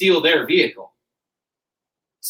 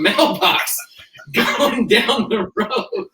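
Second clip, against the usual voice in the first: laughing while telling; 6 semitones higher, much slower than usual. Real, not acted. Laughing through the canoe crash story.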